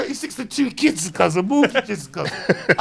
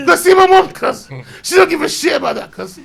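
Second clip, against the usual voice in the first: loud, high, desperate begging; raised, frantic plea; panicked pleading; 7 semitones higher, 8 dB louder than usual. Retold or imitated. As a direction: beg them not to send him home. Pleading with the military police.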